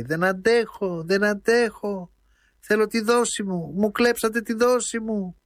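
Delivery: high, slow, strained wail; desperate howl. A narrator's reading. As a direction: cry out for the fix like an addict in withdrawal. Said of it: "άρχισε να ουρλιάζει". (νεαρός ουρλιάζει απελπισμένα, ψηλή αργή φωνή)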